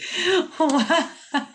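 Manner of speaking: high cackling laugh; burst of laughter. Real, not acted. Reader finding it hilarious, high laughing burst.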